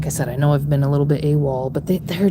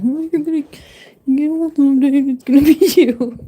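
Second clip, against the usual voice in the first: high, loud mocking whine; mocking people; 8 semitones higher, 7 dB louder than usual. Mocking people who say she'll get old, high and loud. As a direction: sneer it like an annoying know-it-all. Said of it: "I hate it when people say".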